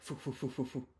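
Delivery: soft breathy puffs of a running player; imitated puffing. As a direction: puff like you're running the bases, quietly. Barnes hustling, imitated.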